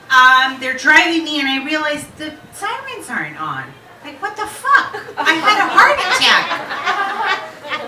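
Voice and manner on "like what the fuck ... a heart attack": high-pitched, loud mock indignation; comic outrage. Patient finding the sirens off, mock outraged. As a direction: protest it high, drawing out the complaint.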